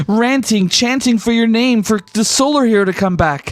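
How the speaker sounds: high, breathless, starstruck junior reporter; excited young reporter. Acted. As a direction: gush at the hero you thought was dead.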